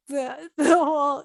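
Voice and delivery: real tears breaking through a laugh; teary laugh. Real, not acted. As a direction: voice catching on the verge of crying. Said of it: "that brings me to tears pretty easily".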